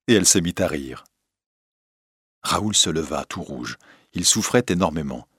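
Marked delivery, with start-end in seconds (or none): none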